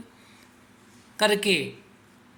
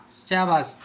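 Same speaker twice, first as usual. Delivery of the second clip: ordinary talk, nothing marked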